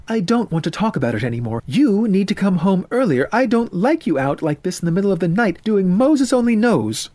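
high, loud, exasperated mother; scolding mother. Acted. Mother wants son home earlier, scolding. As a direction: shut the subject down and scold, pitch climbing.

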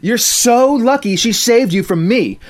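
high, loud boasting threat; bully grandstanding; showy threat. Acted. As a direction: play to the whole lunchroom. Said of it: "put on a show".

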